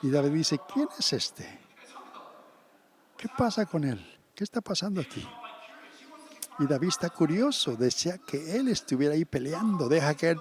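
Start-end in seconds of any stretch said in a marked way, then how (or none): 0.7-5.2 put-on high, puzzled questions from a boy; curious surprise. Boy David asking the soldiers about Goliath, high and puzzled. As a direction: wonder aloud who this giant is.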